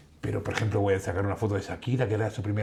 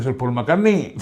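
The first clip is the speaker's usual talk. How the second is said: amused mock cockney, higher and louder; cockney accent; 5 semitones higher, 8 dB louder, slower than usual. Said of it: "así un poco cockney".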